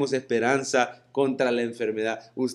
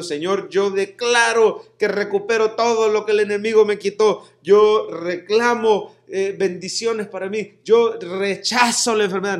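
higher, louder, proud claiming; mock demanding prayer; 6 semitones higher, 5 dB louder than usual. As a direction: parody a believer ordering God around. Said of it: "No, él dijo". (parodia de una oración exigente y arrogante)

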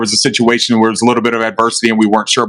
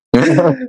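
high, jittery nervous giggle; nervous laugh; 12 semitones higher than usual. Real, not acted. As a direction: laugh off the tension. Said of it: "That was my nervous laugh".

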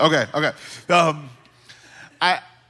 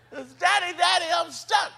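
put-on screaming child; 11 semitones higher than usual. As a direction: imitate a stuck kid calling daddy. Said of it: "he's screaming from the backyard".

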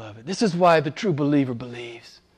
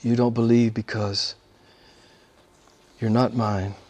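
lower, softer echo of the quote; quiet repeat; 4 semitones lower, 5 dB quieter than usual. Real, not acted. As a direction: let it sink in softly. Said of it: "repeats quote softer".

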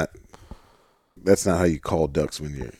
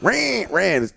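high, pleading quacks; pleading duck call; 11 semitones higher than usual. Shown as a demonstration. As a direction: beg like a hen duck. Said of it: "pleading thing".

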